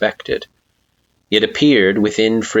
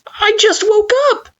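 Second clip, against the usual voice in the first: put-on high anxious child voice; 3 dB louder than usual. Frisk pleads, pale.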